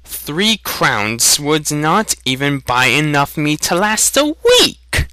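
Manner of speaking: put-on young hunter arguing over meat; indignant young man. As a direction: voice jumping up high at the end. Haggling youth protests.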